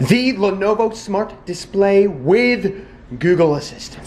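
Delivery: grand sponsor reveal; big announcement. Pretend. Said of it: "drumroll please".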